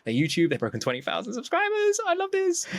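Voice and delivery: rising to a high-pitched gush; retold fan enthusiasm; thrilled. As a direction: build excitement up to a squeaky finish. Retells excitement at subscriber count.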